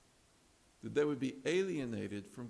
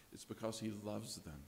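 much softer, quietly stated; softly said; 3 semitones lower, 9 dB quieter than usual. Shown as a demonstration. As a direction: say it gently and low. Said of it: "The doctor begins to plead".

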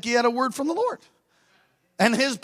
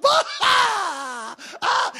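high-pitched helpless laughing burst; acting out uncontrollable laughter; hysterical laughter; 10 semitones higher than usual. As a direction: lose it completely in laughter. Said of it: "He loses it, laughing hysterically".

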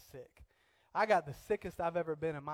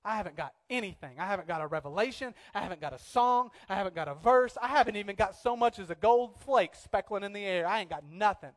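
imitated complaint, building louder and higher; mock indignant rant; 4 semitones higher, 8 dB louder than usual. Preacher retells his sick wife's complaint, mock indignant rant. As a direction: pile up each grievance, hammed-up and increasingly loud. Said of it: "I am mad".

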